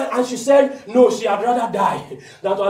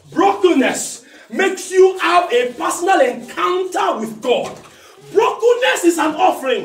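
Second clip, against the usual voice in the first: loud, higher, slow emphatic sermon; preaching at full force; 9 semitones higher, 5 dB louder than usual. Acted. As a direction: drive it home with fire.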